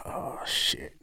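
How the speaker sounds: whispered aside to a friend, imitated; hushed realization. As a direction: breathe it out under your voice. Retold secret look between two friends.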